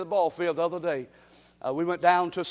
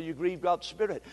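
normal talking voice

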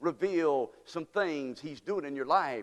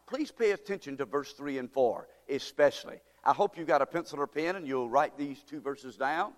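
ordinary talk

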